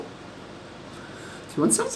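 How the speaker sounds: very high offering tone; high pet talk. Acted. Owner offering lorikeet food, very high. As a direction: coo the offer.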